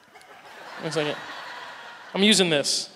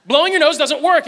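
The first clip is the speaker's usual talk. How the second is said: put-on higher, louder defiant child insisting; mock stubborn child; 6 semitones higher, 8 dB louder than usual. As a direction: sell the four-year-old's stubborn certainty. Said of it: "And he said".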